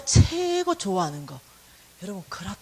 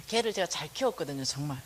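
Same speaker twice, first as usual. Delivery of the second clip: ordinary delivery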